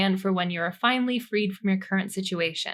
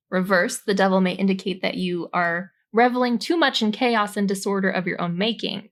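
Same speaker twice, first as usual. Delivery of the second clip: normal talking voice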